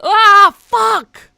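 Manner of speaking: loud, high scared yell; startled scream. Real, not acted. Player startled by something in the game, real scared yell. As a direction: scream as if jumped at.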